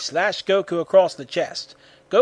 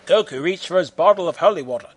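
plain reading voice

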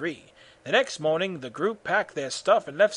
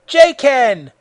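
loud, high attack cry; shout; 8 semitones higher, 11 dB louder than usual. A narrator's reading. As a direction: shout out the fighting move. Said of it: "Goku shouts".

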